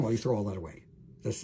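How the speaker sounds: ordinary talk, nothing marked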